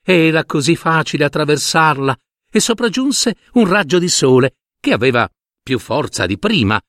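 plain reading voice

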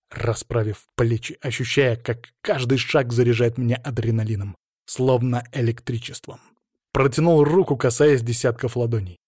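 ordinary delivery

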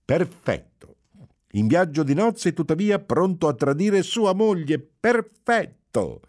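building to a piercing final Perfetto; shrill triumphant shout. A narrator's reading. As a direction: crow over his betrayal. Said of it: "gridò con voce stridula e trionfante".

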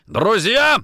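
loud, high indignant exclamation; angry echo. Acted. Nikitin rejecting Orlov's friendship, angry echo. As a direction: throw the word back loudly. Interrogator angrily throws word back.

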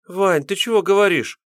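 put-on high, timid young woman's babble; babbling girl. A narrator's reading. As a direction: stammer it out as a scared girl. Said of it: "залепетала девушка".

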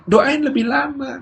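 put-on high, drawn-out child's request; pleading child. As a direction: beg slowly in a little kid's voice. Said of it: "Akhirnya cuma minta". (anak meminta dengan nada tinggi, lambat)

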